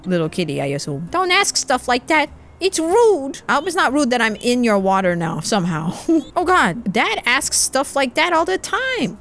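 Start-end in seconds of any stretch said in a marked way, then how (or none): 1.1-3.4 put-on high-pitched child voice, telling off; scolding little kid. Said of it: "let's talk to the kids". 6.9-9.2 put-on high, slow child voice; protesting little kid. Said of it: "let's talk to the kids".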